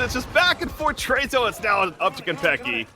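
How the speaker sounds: high, loud hype shouting; esports caster. Real, not acted. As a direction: erupt as the round swings. Clutch defuse, caster erupts.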